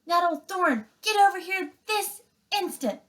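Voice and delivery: high, loud commanding call; stern mother bear. Acted. Mother Bear calling her cubs off the fight, high loud command. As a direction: order them back, no arguing.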